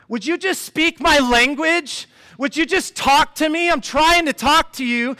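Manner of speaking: loud, high, exasperated plea; frustrated toddler. Acted. Toddler begging dad to understand her language, frustrated. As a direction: drag it out in a fed-up shout. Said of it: "that must be really frustrating for her".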